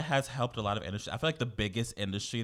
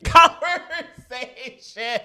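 laughing; 11 dB louder, much slower than usual. Real, not acted. Laughs at own remark.

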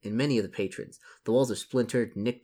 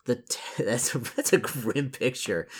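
amused; 4 semitones higher than usual. Real, not acted. Amused comment on the grim scene.

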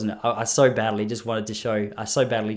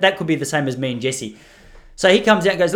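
ordinary delivery

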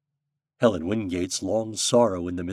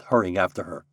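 ordinary delivery, nothing marked

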